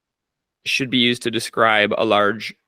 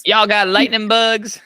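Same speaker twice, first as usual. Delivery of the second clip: mock Southern accent; 10 semitones higher, 6 dB louder, slower than usual. Mock Southern reply, high and slow.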